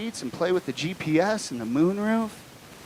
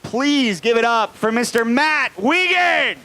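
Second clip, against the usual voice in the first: slow, higher hype intro; announcer intro; 5 semitones higher, slower than usual. Acted. Host brings on Matt to the stage, slow hyped announcer call. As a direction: pump up the crowd for the comic.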